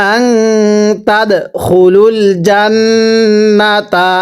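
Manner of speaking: slow, with আং and the ন্ন of জান্নাতা hummed long; ghunnah recitation. Shown as a demonstration. Teacher recites for students to follow, long ghunnah. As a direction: linger nasally on আং and জান্নাতা. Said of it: "গুন্নাহ করে ওয়াজিব".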